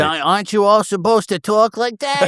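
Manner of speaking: high-pitched put-on New Jersey accent; mock New Jersey accent. Teasing a New Jersey native about his speech, mock New Jersey accent. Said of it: "when you said New Jersey, I was like".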